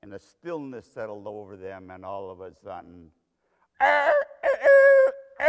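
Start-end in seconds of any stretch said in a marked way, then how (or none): 3.8-5.5 loud, very high imitated cockcrow; rooster crow. Preacher imitating the rooster after Peter's denial, piercing crow. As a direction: crow shrilly like a rooster, in long bursts. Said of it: "The rooster crowed".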